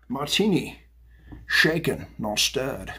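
lightly voiced Sean Connery style, non-rhotic stirred; a hint of a Scottish Bond accent on not stirred; Bond catchphrase. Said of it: "Sean Connery".

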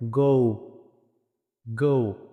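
গো drawn out, closing to "u"; isolated গো with a rounded gliding vowel; correct O glide. Shown as a demonstration. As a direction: model গো slowly as "gou". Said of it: "তখন কী হবে".

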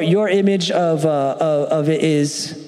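usual speaking voice

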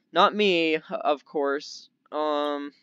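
ordinary talk

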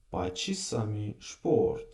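"шпорт" with a clear sh sound, said slowly; s read as sh. Shown as a demonstration. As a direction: pronounce "шпорт" with sh, not s. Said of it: "Як с пишеться, а читається як ш".

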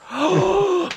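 high sudden gasp; sharp high gasp. Real, not acted. Sudden realization of pun.

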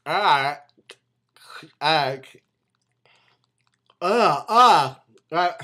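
wide open tense mouth; grimacing exaggerated vocalizing; shouting with strained face. Real, not acted. Seen 1: No tobacco wad visible; wide open, tense grimacing mouth throughout.

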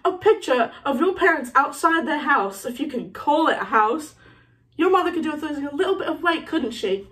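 taunting bully, needling a rival; mocking insult, lilting up and down; Malfoy. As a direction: goad him about his family.

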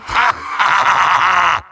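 big staccato villain laugh; evil cackle. Acted. Villain gloating over his foe's doom, evil cackle.

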